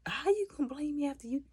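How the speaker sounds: high-pitched mock indignation; imitated high, incredulous protest; indignant retort. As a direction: throw the blame back, offended. Accused kid retorts, indignant.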